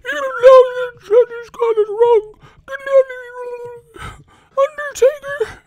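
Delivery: very high, loud wailing voice; hammed-up wrestling manager's wail; Paul Bearer. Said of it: "fucking Paul Bearer".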